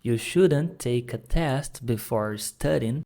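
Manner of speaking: "take a" run together as one sound; slow model sentence with "take a" joined; linked take a. Shown as a demonstration. Teacher models linked speech for students. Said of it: "O take eu juntei lá no A".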